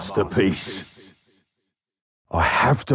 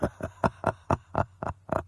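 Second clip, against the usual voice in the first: soft unvoiced laugh in the dark; quiet sinister chuckle; breathy laugh; 7 dB quieter than usual. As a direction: laugh under your breath, menacing. Villain laughs softly in dark.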